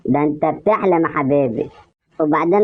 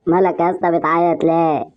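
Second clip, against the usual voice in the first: usual speaking voice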